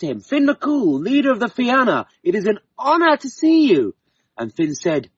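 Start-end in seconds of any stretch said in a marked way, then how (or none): 0.2-3.9 higher, lightly voiced fairy woman; a light touch of fairy woman's voice; fairy woman greeting. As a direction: greet the hero with honour.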